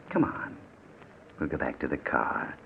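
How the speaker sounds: normal talking voice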